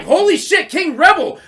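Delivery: high, loud surprised exclamation; shocked recognition. Retold or imitated. Spotting his old enemy in the game, shocked. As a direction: gasp out the rival's name. Retold surprise at meeting rival.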